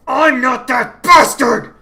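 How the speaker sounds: put-on boy enraged at being mistaken for a villain; furious bellow. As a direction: bellow it with all your fury. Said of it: "he bellowed".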